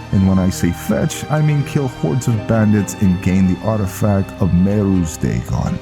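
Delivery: sheepish Viking explaining himself. Acted. Viking admitting what fetching really means, animated. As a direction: break the bad news to the commander.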